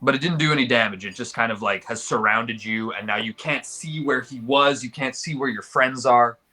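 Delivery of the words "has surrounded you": normal talking voice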